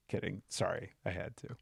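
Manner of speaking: ordinary talk, nothing marked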